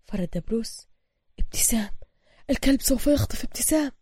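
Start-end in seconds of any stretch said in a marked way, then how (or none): 1.4-1.9 breathless whisper of a name. Acted. Bruce whispers the name in sudden alarm. 2.5-3.9 alarmed. Acted. Bruce realizes the kidnapping.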